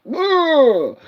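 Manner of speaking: high hammed-up whoa; exaggerated awed whoa; mock amazement. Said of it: "he just acts out like".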